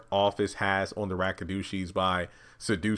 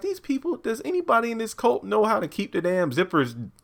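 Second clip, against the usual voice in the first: high-pitched comic disbelief; mock exasperation; 13 semitones higher than usual. Host fed up with the cult, mock exasperation. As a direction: complain in a put-on high voice.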